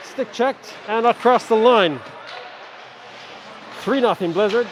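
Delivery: quick, lively game call; live play-by-play. Acted. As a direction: follow the puck as you call it.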